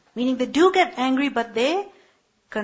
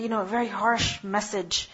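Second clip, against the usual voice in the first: ordinary talk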